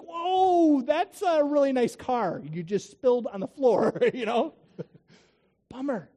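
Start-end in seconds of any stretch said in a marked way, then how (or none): 0.0-4.0 high, loud "whoa" of dismay; hammed-up alarm, pitched way up; mock shock. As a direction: exclaim like an appalled onlooker. Acted shocked reaction to a spill.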